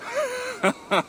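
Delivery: short high scoffing laugh; mocking laugh. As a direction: laugh off the jab. Arke laughs at the taunt.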